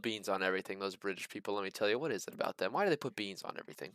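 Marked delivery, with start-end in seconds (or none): none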